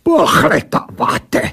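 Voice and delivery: accented creature mimicking the question; creature blurting. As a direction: throw "Pohledáváte" back, drawn out and scornful. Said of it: "vybavla ta věc".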